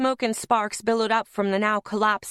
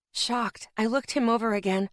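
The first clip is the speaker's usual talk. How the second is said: usual speaking voice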